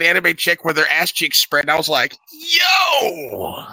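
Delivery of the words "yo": high startled yell; shocked shout. Retold or imitated. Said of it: "He went aggressive right out the gate".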